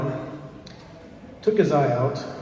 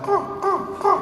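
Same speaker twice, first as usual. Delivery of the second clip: very high cawing cry; bird call; slower than usual. A narrator's reading. Kokoini turns back into a bird, high cawing. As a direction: caw like a raven flying off. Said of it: "Calls out".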